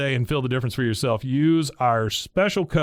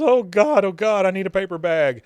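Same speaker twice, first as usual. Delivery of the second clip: high, loud hyperventilating-style wail; mock panic; 7 semitones higher, 7 dB louder than usual. Niece needing a paper bag, acted high panic.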